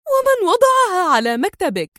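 high-pitched agitated question; worked-up, high character voice. A narrator's reading. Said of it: "وهتفت في انفعال".

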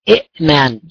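إئمان with a glottal stop after the first i, i'-man; original form with two hamzas. Shown as a demonstration. Teacher showing the awkward original form to students, hamza stop clearly heard. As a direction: break إئمان with a hard hamza stop before mim. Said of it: "أصل إيمان".

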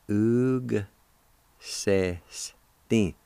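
"Ug ... se ... stin", each syllable held and separated. Shown as a demonstration. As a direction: sound out "Ug se stin" slowly, G joined to the U. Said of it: "you can pronounce the G sound with the U like this".

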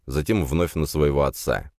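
usual speaking voice

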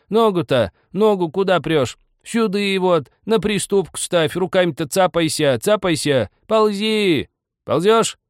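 very high, loud scolding directions; old woman shouting. A narrator's reading. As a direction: shout orders as you shove him up. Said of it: "кричала".

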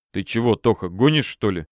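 ordinary delivery, nothing marked

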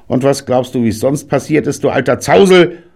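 high, loud shot back; a card player firing back at granddad. A narrator's reading. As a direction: snap back at the old man. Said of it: "schoss Lonnie zurück".

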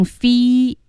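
"fe" said wrongly as a high, drawn fee; wrong long ee. Shown as a demonstration. Co-teacher warns learners of the mistake, high long "fe" as fee. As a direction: pitch up a stretched, tense "fe" like fee. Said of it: "不要读成fe".

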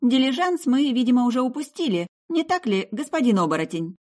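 put-on girl shouting a pointed question; loud call upward. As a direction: call up to someone in a tree. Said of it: "то громко крикнула".

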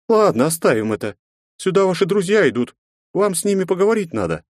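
lightly voiced brisk woman; a hint of the landlady; woman's voice. Mother speaks to guest.

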